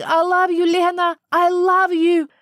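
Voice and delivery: high, loud desperate scream. A narrator's reading. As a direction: scream it after her daughter. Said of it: "I heard her screaming".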